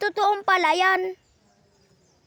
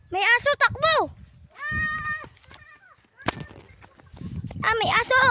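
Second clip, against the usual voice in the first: alarmed shout; 8 semitones higher than usual. Pretend. Fleeing a dog, calling out.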